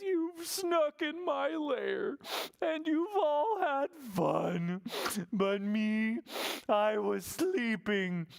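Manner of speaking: high, slow whimpering bear voice; sad Bear. Acted. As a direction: sniffle through the complaint. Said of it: "Bear is so sad".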